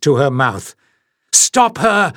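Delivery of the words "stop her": urgent shout. A narrator's reading. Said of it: "Shan exclaimed urgently".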